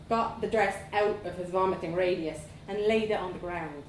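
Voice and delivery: ordinary delivery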